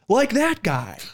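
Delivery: high pointed voice; imitating her. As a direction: call him out up high. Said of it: "she goes".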